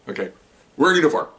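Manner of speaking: usual speaking voice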